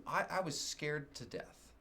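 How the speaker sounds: ordinary delivery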